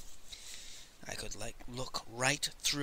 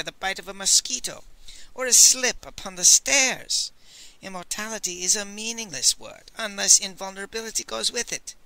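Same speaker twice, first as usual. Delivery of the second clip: higher, louder, mocking old man; scornful old scholar; 7 semitones higher, 6 dB louder than usual. A narrator's reading. Master Li scoffs at the Old Man, high and scornful. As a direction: laugh off the offer as nonsense.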